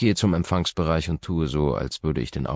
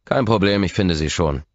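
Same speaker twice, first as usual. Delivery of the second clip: louder, growled retort; 4 semitones higher, 6 dB louder than usual. Acted. Man growling at the nurse before storming off. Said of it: "knurre ich".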